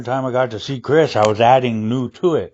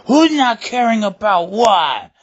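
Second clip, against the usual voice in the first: playful; 9 semitones higher than usual. Retold or imitated. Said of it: "I was, like, going".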